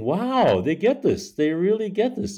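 high-pitched, wowed exclamation; amazed reaction. Retold or imitated. Playwright retelling a reaction to the audience, amazed. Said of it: "And I'm, and I'm going".

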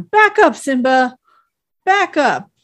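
imitated cartoon yell at a lion; imitating Shaggy yelling. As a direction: bark it like a scared lion tamer. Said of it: "we hear Shaggy going".

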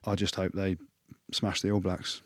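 normal talking voice

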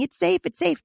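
high, mocking sing-song repeat; mocking parrot. Mocking the knuckleheads on the news, high mock sing-song. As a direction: mimic the news pundits with scorn. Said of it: "these knuckleheads on the news right now saying".

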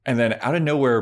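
ordinary talk, nothing marked

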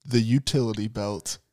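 mock-epic low reveal; movie-trailer announcer. Completes the mock trailer introduction.

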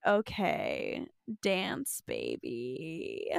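low, soft mock line reading; understated, deadpan imitation, trailing downward; Carly. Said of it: "And Carly's like".

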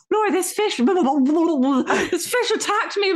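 high, spluttering imitation of panic; mock panic. Snorkeller telling partner a fish attacked him, mock panicked. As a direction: wail it through laughter, hammed-up alarm.

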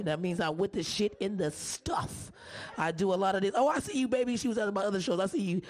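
ordinary delivery

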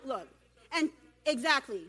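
usual speaking voice

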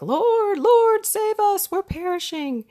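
high, loud mock panic, pleading to the Lord; panicked cry for help. Disciples in the storm begging Jesus to save them, high panicked cry. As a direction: cry out like sailors sinking in a storm. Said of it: "and they're like".

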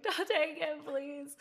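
mock plea to get the camera back; a hint of panicked teen; pleading. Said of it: "and I was like".

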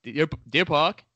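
Long Island conductor's accent, "Park" without its R; Long Island Rail Road accent. Shown as a demonstration. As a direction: demonstrate the local Long Island "Deer Pahk". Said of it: "that's how they say it on the L I double R".

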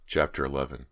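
usual speaking voice

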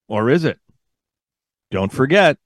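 ordinary talk

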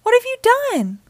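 high-pitched, aghast question; shocked cry. A narrator's reading. Mom discovering what the boys did, shocked cry. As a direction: demand it in a high, startled voice. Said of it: "cried Mom".

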